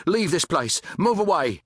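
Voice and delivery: high-pitched urgent shout; shouted warning. A narrator's reading. Shaking soldier warns off a mob, shouting.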